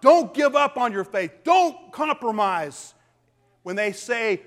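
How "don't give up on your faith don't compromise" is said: louder, pleading call to persevere; urgent exhortation. Retold or imitated. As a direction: urge them not to give in. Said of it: "And he's telling them".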